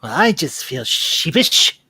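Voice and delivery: light imitation of the Genie's line, slowed; lightly voiced Robin Williams Genie delivery; a slowed, lightly put-on movie-quote voice. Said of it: "To quote the great, late great Robin Williams from Aladdin".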